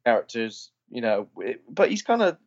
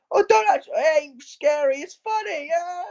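much higher, sneering mock voice, slowed on ain't scary; mocking a loud filmgoer. Drunk woman in the cinema boasting to everyone, high sneering mock voice. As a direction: sneer it like the know-it-all in the cinema. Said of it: "shouting out things".

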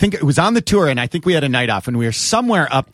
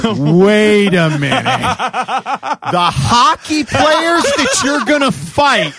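slow, louder and high disbelief; incredulous; 6 semitones higher, 4 dB louder, much slower than usual. Retold or imitated. To Goop about the hockey players, slow incredulous. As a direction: draw it out in amazed disbelief.

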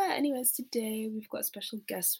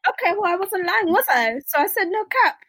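higher, louder playful insistence; teasing self-defence; excited; 12 semitones higher, 17 dB louder than usual. Real, not acted. Joking about using slang.

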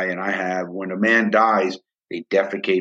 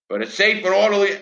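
higher, sing-song parody of prison notices; mock official wording; 11 semitones higher than usual. Ex-prisoner mocking the prison's official excuse, sing-song. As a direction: recite it like a smug memo. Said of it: "has a great wording that gets them out of trouble".

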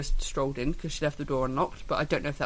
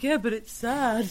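slow, higher-pitched, mildly plaintive protest; mock plaintive; 5 semitones higher, slower than usual. Parent defending crying to teasing boy, plaintive. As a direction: plead your case, drawn out and higher.

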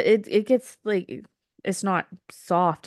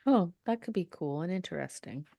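whisper; 6 dB quieter than usual. Real, not acted. Hushed reply while recording.

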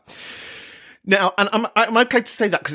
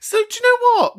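excited exclamation; 4 dB louder than usual. Real, not acted.

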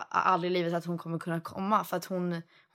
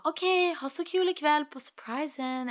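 high, bright mock-cheery voice of the old babysitter; lightly mimicking Celine letting the surprise slip; imitating Celine; 7 semitones higher than usual. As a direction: put on a much higher, sing-song voice. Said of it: "Och hon bara".

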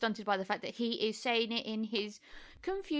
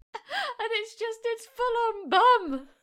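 laughing; 11 semitones higher, 4 dB louder, slower than usual. Real, not acted. Laughing while describing the butt.

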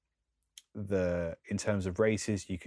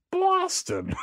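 high squeaky mock Boston Irish; playful fake Irish accent, much higher; 7 dB louder than usual. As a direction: toss off a jokey Irish Boston. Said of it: "That's my Irish accent".

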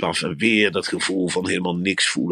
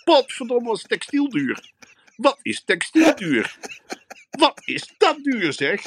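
outraged shopper. Acted. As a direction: rant high and scandalised at the price tags. Indignant shopper in the shop. (zeer hoog en verontwaardigd tierend over de prijzen)

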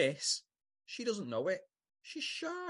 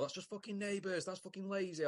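a hint of indignant Tim, pitched up but soft; imitating Tim; 6 semitones higher, 6 dB quieter than usual. Imitating a co-host ranting about lazy writing, mock indignant. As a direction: grumble it high in mock indignation. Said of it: "And Tim would go".

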